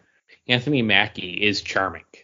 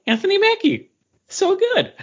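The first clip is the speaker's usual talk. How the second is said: retold gush, pitched much higher and louder; gushing excitedly; 4 dB louder than usual. Leaving the movie, raving about Anthony Mackie: high excited gush. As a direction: replay the thrilled fan leaving the cinema. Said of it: "I walked out of that movie being like".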